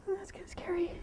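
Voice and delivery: hushed nervous whisper; whispered aside. Real, not acted. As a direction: mutter it softly so she stays calm. Nervous aside while horse quivers.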